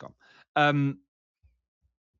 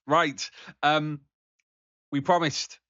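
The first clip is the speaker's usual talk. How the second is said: ordinary talk, nothing marked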